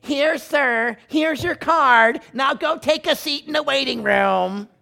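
high, dismissive front-desk voice; receptionist. Retold or imitated. Desk lady to pastor, brushing him off high. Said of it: "quote of receptionist".

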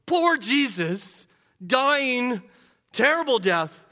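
preaching at full force. Acted. Pastor pleading with congregation, impassioned full-force preaching. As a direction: drive the point home like a revival preacher.

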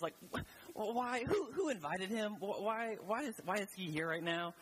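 high, stammering, incredulous protest; exasperated. Acted. Annoyed host protesting about an unwanted uncle, exasperated.

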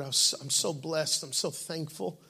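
usual speaking voice

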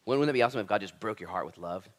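usual speaking voice

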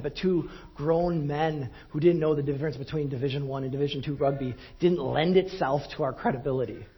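usual speaking voice

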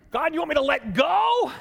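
high, loud disbelieving question; can't believe what you're hearing; incredulous. Acted. Hanging man questions God's order, high loud disbelief.

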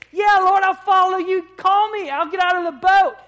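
high, booming cry of zeal; eager shouting. Acted. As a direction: shout it out as an overeager disciple. Acts out Peter's eager boast to Jesus.

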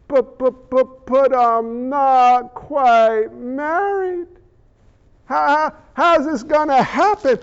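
halting, drawn-out bewildered reply; hesitant stammer. Acted. Unmarried Mary questions the angel, stammering in bewilderment. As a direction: stumble over the first words, then stretch them.

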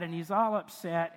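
usual speaking voice

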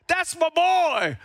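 high, slow proud yell; proud dad yelling. Retold or imitated. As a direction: call it across the field like a proud dad. Dad screaming at soccer.

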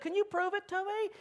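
very high, whiny plea; pleading Gideon. Acted. Gideon begging God for proof, high and whiny. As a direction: ask it like a nervous doubter.

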